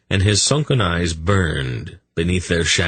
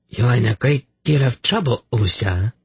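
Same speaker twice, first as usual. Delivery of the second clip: throaty purr; 6 semitones higher than usual. A narrator's reading. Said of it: "her throaty voice almost purring".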